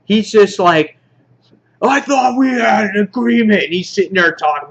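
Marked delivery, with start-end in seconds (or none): 1.8-3.6 high, put-on outraged cry; hammed-up protest in a raised voice; mock flipping out. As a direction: act out a man losing it. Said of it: "you see Rick flip out".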